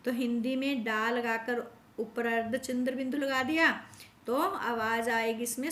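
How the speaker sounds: ordinary talk, nothing marked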